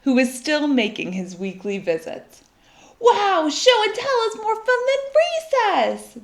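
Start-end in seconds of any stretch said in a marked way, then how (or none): 3.0-6.2 put-on high, loud cheer of a class of kids; excited kids yelling. Kids shouting at show and tell, high and loud. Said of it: "they yelled".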